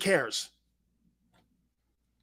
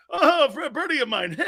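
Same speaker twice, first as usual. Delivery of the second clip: caller bit; 8 semitones higher than usual. Retold or imitated. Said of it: "Dude, the bit sucked".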